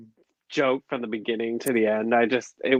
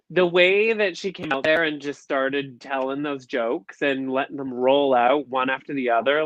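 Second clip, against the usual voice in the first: normal talking voice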